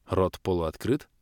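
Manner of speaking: ordinary delivery, nothing marked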